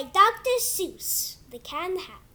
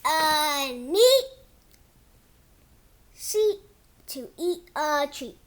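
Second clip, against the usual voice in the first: put-on reading to kids; 3 dB louder than usual. Kid reading his book to viewers in storybook voice. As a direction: read it like a bedtime book for little ones. Reads picture book aloud.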